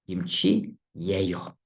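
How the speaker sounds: "Kimchi yeyo" said slowly, every syllable clear; slow demo of kimchi yeyo. Shown as a demonstration. Teacher models "Kimchi yeyo" slowly for learners to copy. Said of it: "You pronounce it like that".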